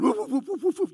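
fast, loud, high hound barks; rapid barking. Shown as a demonstration. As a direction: bark fast like the dog is close. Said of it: "I'm getting close to him".

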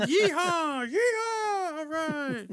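high laughing cowboy yeehaw. Retold or imitated. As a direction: whoop it out like a rowdy cowboy server. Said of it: "Texas Roadhouse birthday".

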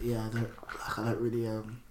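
very soft, low, hesitant murmur; imitated furtive whisper. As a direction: imitate someone trying not to be overheard. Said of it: "he was trying to whisper it".

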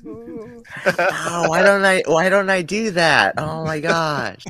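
high, loud and slowing in awe; amazed. Real, not acted. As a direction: marvel at it out loud. Amazed by the synth patch.

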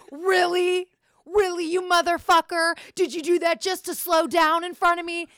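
high, loud outraged yell; road rage. Retold or imitated. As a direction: yell it at the driver ahead, outraged. Acts out driver's road rage.

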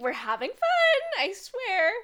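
high, loud mimicry of forced cheer; mock fake enthusiasm. Co-host teasing her friend's fake-sounding thanks, mock enthusiasm. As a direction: exaggerate your co-host's insincere pep. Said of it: "You're like".

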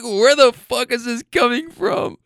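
high-pitched, retold disbelief; shook. Retelling the boyfriend's reaction to her demand, shook. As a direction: imitate a thrown, baffled boyfriend. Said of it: "he was just so shook".

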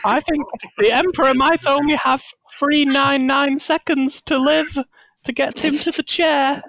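high, put-on guardsman voice; hammed-up high worry; anxious guardsman. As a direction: play a fretful soldier at high pitch. Plays the guardsman.

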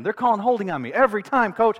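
high, loud imitation of a griping player; complaining. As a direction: protest to the coach as a player would. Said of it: "he starts complaining".

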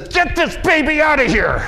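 high, loud desperate cry; mother in labour. Retold or imitated. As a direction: shout it like a woman giving birth. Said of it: "a young lady who says".

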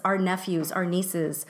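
ordinary talk, nothing marked

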